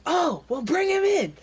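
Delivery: high, loud order; guard yelling to bring the prisoner in. Acted. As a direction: shout the order, rising high. Guards shouting across hallway.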